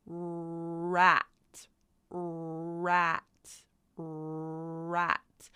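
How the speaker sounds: "rat" with a long rrr before the vowel; stretched initial R. Shown as a demonstration. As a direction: hold the R, then drop into "rat". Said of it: "So if we say rat".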